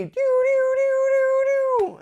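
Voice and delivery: high held doo sound; imitated air horn. Overseer blowing the air horn, imitated doo. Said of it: "this air horn".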